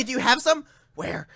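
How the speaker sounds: a hint of a twitchy addict; lightly voiced jittery crackhead; twitchy slurred sniffing addict. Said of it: "Sounding like a crackhead".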